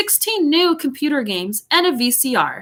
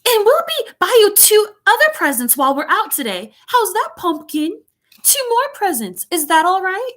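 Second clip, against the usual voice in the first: high British mum, anxious to appease; high, fussy, placating mother voice; hurried placating mother; 9 semitones higher than usual. A narrator's reading. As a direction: coax a spoiled child in a high-pitched voice. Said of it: "because she said quickly".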